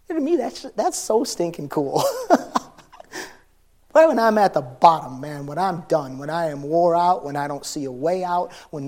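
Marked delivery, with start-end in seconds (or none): 0.1-2.7 real laughter breaking through the words; laughing with delight, much higher. Real, not acted. Laughs at his own telling.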